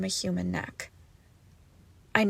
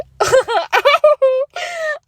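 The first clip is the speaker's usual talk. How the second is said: a high, loud cackle; manic laugh; 12 dB louder than usual. Acted. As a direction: burst out laughing at her fear. After mocking captive.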